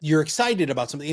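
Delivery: quick, rushing demo of excitement; sped-up excited. Shown as a demonstration. Coach showing listeners fast excited pace. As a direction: speed it up like you're excited. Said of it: "if you speed up".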